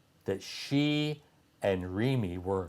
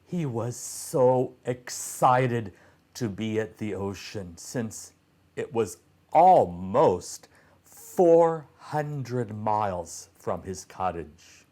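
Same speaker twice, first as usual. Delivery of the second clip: plain reading voice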